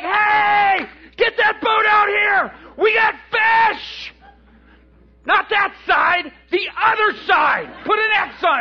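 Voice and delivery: very high, loud long-distance call; excited hollering. Acted. Peter calling the other boat out, excited shouting. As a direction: holler orders across the water, thrilled.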